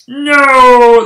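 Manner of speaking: loud drawn-out mock no; Vader's no. Kid reenacting Vader's episode three no, loud yell. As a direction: wail the no like Vader. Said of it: "The same exact one".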